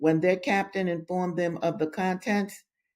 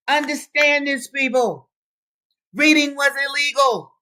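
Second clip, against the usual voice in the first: loud, high, urgent proclamation; impassioned exhortation; 8 semitones higher, 6 dB louder than usual. Real, not acted. Reader warning her people reading was illegal, impassioned. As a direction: raise the voice high and hammer it home.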